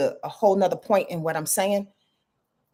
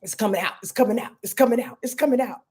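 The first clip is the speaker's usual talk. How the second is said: repeated inner voice; 6 semitones higher, faster than usual. Retold or imitated. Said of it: "I just kept hearing".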